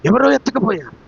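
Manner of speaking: acted alarm. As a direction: exclaim as if discovering a loss. Acts out shock at empty tomb. (ఆశ్చర్యంతో కంగారుగా)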